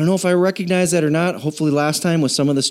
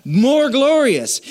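more glorious stretched slow and high; drawn-out refrain; 9 semitones higher, much slower than usual. Acted. Preacher stretching the refrain for the church. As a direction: lean on more, slowly.

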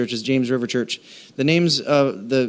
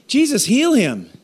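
sudden high, louder blurt; 11 semitones higher, 4 dB louder than usual. Retold or imitated. Older wife blurting a prayer at a boy with a cast, sudden high blurt. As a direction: blurt the prayer out before thinking. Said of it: "just blurted out".

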